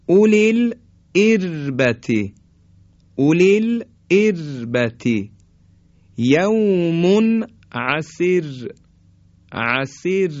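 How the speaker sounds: slow recitation, thin R in اربتی and عسیر; light R. Shown as a demonstration. (اربتی و عسیر کشیده با «ر» نازک)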